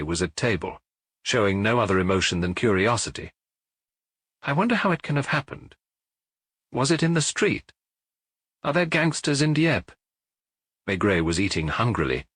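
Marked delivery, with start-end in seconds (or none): 4.4-9.9 curious lady. A narrator's reading. Madame Mosley curious at table.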